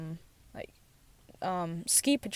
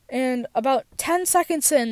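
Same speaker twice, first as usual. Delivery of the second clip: ordinary talk, nothing marked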